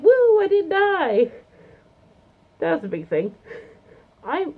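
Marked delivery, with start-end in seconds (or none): 0.0-1.3 loud, high, drawn-out whoop of relief; relieved cheer. Retold or imitated. Reaching the bottom of the escalator, a loud slow whoop. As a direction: cheer like you just survived the ride.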